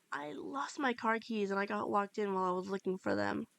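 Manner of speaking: plain reading voice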